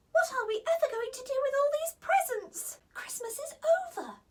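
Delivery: high, breathy little fairy; fretting fairy; worried fairy. Acted. Holly worries about presents.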